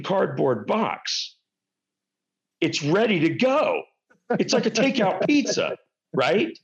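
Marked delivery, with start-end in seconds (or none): none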